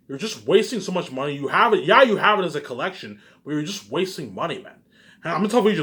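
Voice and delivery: ordinary talk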